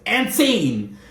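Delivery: high, theatrical "And scene!"; theatrical sign-off. Retold or imitated. Marlo storming off mid-argument, theatrical. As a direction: announce the exit like the end of a play. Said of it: "she's like".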